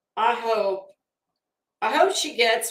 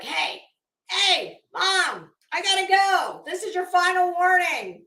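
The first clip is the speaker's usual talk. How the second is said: higher, lightly voiced pleading dog; voicing the dog; 8 semitones higher than usual. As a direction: speak for the dog at the door, pitched up, whiny. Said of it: "a dog that's at the door that's like".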